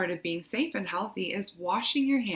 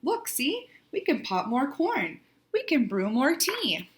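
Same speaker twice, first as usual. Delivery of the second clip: lightly squeaked little mouse voice, pitch jumping up; a hint of a squeaky mouse; 5 semitones higher than usual. A narrator's reading. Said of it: "Mouse squeaks".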